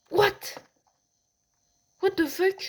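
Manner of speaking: shocked outburst. Real, not acted. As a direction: blurt it out in total shock. Startled by game event. (cri de surprise choqué)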